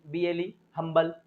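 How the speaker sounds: ordinary delivery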